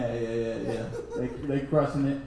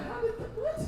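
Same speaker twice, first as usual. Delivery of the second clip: high, baffled mock voice; baffled coworker; 9 dB quieter than usual. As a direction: squeak it out like a puzzled coworker.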